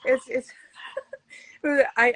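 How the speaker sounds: normal talking voice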